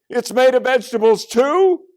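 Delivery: high, loud, yelled question; kid yelling. A narrator's reading. Another kid yells about the dessert, high and loud. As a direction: shout the question in amazement. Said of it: "another kid yelled".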